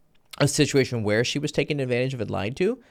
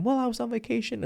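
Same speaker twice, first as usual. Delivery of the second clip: put-on girl's voice; 12 semitones higher, 4 dB quieter than usual. Woman's reply voiced.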